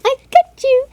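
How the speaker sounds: high, loud playful monster tease; playful teasing. Pretend. Photographer teasing toddler with dinosaur game, playful. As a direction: pretend to gobble the kid up.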